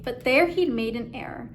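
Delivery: plain reading voice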